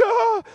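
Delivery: sudden high helpless "ah"; high overwhelmed groan. Retold or imitated. Pastor overwhelmed by God's prompting, high helpless cry. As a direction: let out a squeak of overwhelm.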